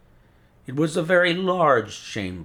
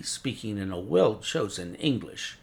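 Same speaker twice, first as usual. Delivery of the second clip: ordinary delivery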